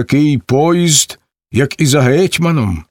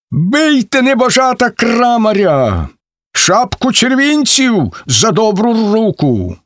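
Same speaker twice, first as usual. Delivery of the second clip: shouting order; 10 semitones higher than usual. A narrator's reading. Ivanets waving the mace shouts a reward, yelling. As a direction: whip the mob into attacking. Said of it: "кричить".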